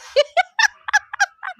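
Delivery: high, long laugh. Real, not acted. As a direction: laugh at the odd word. Laughs at "singenges.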